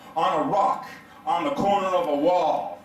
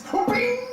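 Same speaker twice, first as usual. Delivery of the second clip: high bing. Retold or imitated. Sound of toe hitting dumbbell.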